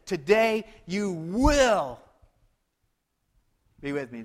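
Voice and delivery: slow, drawn-out "Today you will"; slow tender promise. Acted. Jesus reassuring the thief on the cross. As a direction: stretch each word like a vow.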